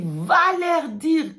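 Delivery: slow, loud, high command; commanding shout. Pretend. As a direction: boom it slowly like a command. God commands the preacher.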